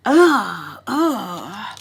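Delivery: loud exasperated ugh; frustrated groan. Real, not acted.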